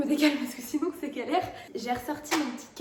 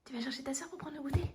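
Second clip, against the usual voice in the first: whispering; 3 semitones higher, 5 dB quieter than usual. Real, not acted. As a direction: whisper it gently. Whispering to toddler.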